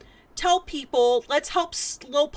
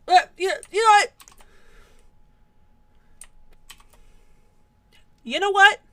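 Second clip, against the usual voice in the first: higher, louder you know what; mock hype; 8 semitones higher, 6 dB louder than usual. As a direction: build up fake excitement. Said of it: "This is the energy I need to bring".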